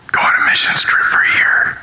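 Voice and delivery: voiceless whisper, as God answering; whispered divine voice. Acted. God answering someone choosing school or work, whispered. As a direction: slip the instruction in as a stage whisper.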